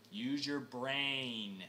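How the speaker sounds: usual speaking voice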